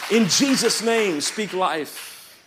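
high, very loud rallying preacher; full-force preaching. Real, not acted. Preacher urging the church to speak life, full-force shouting. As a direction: drive each line home with a shout.

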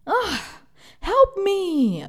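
slow, high pleading cry; mock sobbing plea for help. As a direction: beg for help, voice straining high. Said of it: "the woman was literally crying, like".

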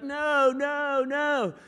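high, loud squealing no's; a tickled little girl pushing back; toddler protest. Retold or imitated. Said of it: "and she's like".

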